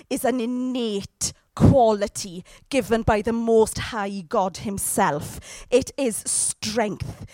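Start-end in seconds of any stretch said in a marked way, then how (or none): none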